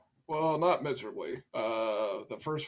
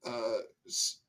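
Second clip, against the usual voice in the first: usual speaking voice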